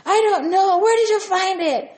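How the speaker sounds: high, loud mock yell; hammed-up angry denial, thrown straight back; Gino. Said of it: "he starts to yell and he's angry".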